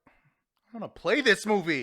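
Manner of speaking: slow, high-pitched mocking voice; drawn-out mock enthusiasm; mock eager. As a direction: play a silly, over-keen fan. Mocking an imagined eager viewer.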